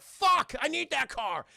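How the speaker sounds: high, loud opening curse; urgent mock outburst. Robert Patrick needing the police car, urgent mock shout. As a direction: explode on the curse, stay high.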